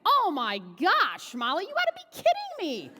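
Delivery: very high, loud shrieking mom in disbelief; mom shocked. Retold or imitated. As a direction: shriek it like a stunned mother. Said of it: "She's livid".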